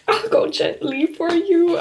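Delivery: high, soft and slow, upset; not okay. Real, not acted. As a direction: quote it tenderly in a high, wounded voice. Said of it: "I'm actually not okay".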